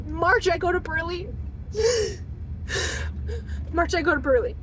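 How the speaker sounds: real sobs between repeated words; tearful. Real, not acted. Wife recounting months of pleading, tearful. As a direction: push the words out through crying. Crying while listing months of trouble.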